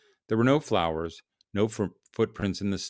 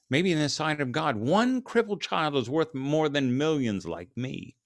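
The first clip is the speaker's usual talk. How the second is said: grieving writer; 7 semitones higher than usual. A narrator's reading. Grieving writer concludes to his editor, high and impassioned. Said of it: "the grieving writer responded, jolted from his grief".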